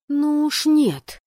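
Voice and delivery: high, loud, drawn-out defiance; emphatic indignant refusal; hissed refusal. Acted. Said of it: "прошипела я".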